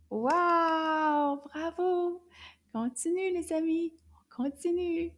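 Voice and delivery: high, lilting encouragement; enthusiastic praise. Real, not acted. Teacher urges class to continue, enthusiastic. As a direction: cheer them on in a high voice.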